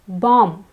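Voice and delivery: Bomb ending on M, no final B; silent B. Shown as a demonstration. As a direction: say Bomb as bom. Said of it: "the B is silent".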